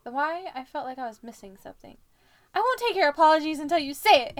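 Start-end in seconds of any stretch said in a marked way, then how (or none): 2.5-4.4 loud, high demanding yell; hammed-up loud scream. A narrator's reading. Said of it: "Harry screamed".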